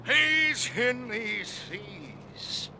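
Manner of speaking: slow, sinking to a soft hush; brooding fade. Acted. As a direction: muse darkly to himself. Ahab's brooding whisper.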